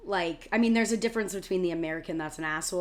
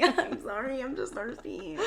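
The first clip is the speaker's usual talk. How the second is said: meek apology; 7 semitones higher than usual. Retold or imitated. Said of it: "I'm like".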